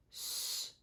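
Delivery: S as a long unvoiced hiss. Shown as a demonstration. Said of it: "instead of S".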